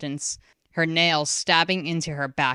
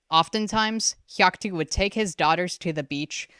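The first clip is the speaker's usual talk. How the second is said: ordinary delivery, nothing marked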